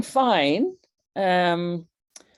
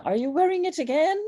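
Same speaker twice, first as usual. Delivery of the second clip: lightly mocked husband, higher and fast; quick, higher nagging husband impression; imitating husband; 8 semitones higher than usual. Wife imitates her husband nagging about the device, quick and higher. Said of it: "my husband's, uh, asking me".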